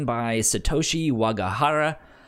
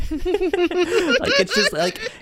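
laughing squeal; 4 dB louder, much slower than usual. Real, not acted. Laughing at silly titles.

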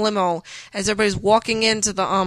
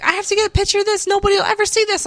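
much higher, fast giddy mimic; mocking maid of honor; 12 semitones higher, faster than usual. Bride mimicking Stacy eager to photograph the meltdown, fast and high. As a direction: rattle it off high and gleeful. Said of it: "Stacy's like".

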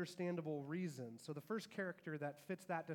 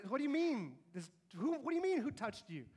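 incredulous disciples; 5 semitones higher, 4 dB louder than usual. Acted. Disciples incredulous at Jesus' question.

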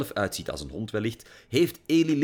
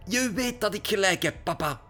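fuming; 12 semitones higher than usual. A narrator's reading. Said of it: "brieste ze".

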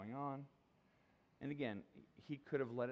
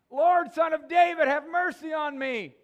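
full-throated cry, much higher and louder; top of his lungs; 19 dB louder than usual. Acted. Blind beggar crying out to Jesus in a full-lung shout. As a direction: bellow a desperate plea for mercy. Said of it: "the language there is like the top of his lungs".

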